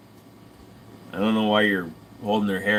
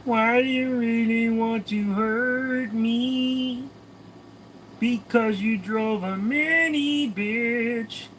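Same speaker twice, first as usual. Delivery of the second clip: very high, slow squeaky put-on voice; high falsetto taunt; mock high voice; 12 semitones higher, much slower than usual. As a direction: squeak it out slowly in a silly falsetto.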